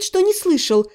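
plain reading voice

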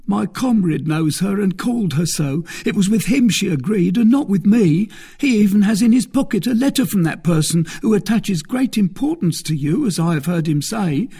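high-voiced, anxious captive; confessing bandit. A narrator's reading. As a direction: shift the blame in a high, urgent voice. Frightened bandit confesses to captor.

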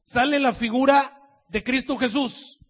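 loud, high, drawn-out preaching; fervent preaching. Acted. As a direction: proclaim it with full force. Preaching at full force. (predicación fervorosa y fuerte)

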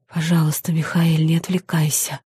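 soft breathy moan, almost a whisper; moaning plea. A narrator's reading. Maya begs the demon to keep kissing, breathy moan. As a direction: moan it out softly, begging him. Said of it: "простонала я".

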